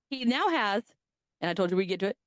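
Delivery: ordinary delivery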